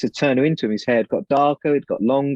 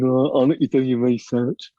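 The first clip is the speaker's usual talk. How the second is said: badly faked rock-star impersonation. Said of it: "my poor [name] impersonation".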